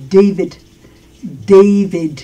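"David" as DEY-vid, firm D between D and T; English D demo. Shown as a demonstration. Teacher lets learners hear the D, "David" said DEY-vid. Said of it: "oyes cómo suena la D?".

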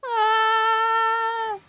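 high, loud shriek of alarm; panicked scream. Real, not acted. Player panicking at the fast minigame, screaming. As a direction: let out a sharp scream as it goes wrong.

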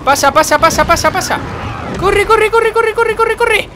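urging climbing to a very high shout; loud, squealed, repeated hurry-up; frantic urging. Real, not acted. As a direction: cheer them through in mounting panic. Frantic urging as escape succeeds.

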